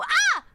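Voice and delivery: sudden high, loud "Ah!"; short piercing test scream; demo scream. Shown as a demonstration. Streamer screaming out of normal talk to test audio, short scream. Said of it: "what if I go".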